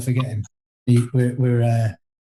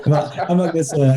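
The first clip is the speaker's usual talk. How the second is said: laughing; 5 semitones higher than usual. Real, not acted. Reacting to co-host's brutal joke.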